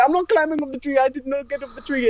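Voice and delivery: high-pitched imitation of an indignant friend; Levente. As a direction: mimic his protest, pitched well up. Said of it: "in his voice".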